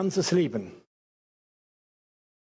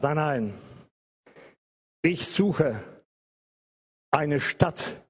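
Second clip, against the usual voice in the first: Abraham's firm reply; 5 semitones lower, slower than usual. Retold or imitated. Abraham quoted refusing.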